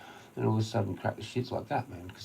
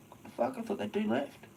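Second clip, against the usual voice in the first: much higher, startled put-on voice; mock surprise; 12 semitones higher than usual. Spotting the car again, high mock surprise. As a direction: gasp it out like he just saw a ghost. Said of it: "I'm like".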